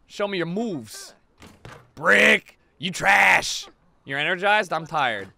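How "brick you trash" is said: 1 trash-talk shout. Pretend. Trash-talking a missed basketball shot.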